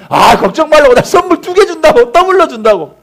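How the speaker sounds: high, loud, insistent promise; emphatic reassurance. Retold or imitated. Pastor promises the crying boy gifts, emphatic reassurance. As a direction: brush away worry with a big promise.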